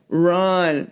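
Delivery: normal talking voice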